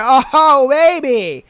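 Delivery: loud, high mock lust at the robe; excited boyfriend. Boyfriend sees her in a robe, hammed-up excited. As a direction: ham up a guy seeing her in a robe. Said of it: "and he's like".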